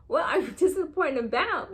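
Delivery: incredulous laugh. Real, not acted. Outraged at boyfriend, laughing.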